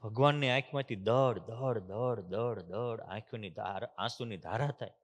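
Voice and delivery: softly dwelling on tears pouring down. A narrator's reading. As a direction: voice sinks, dragging out દળ દળ. God weeping, tears flowing.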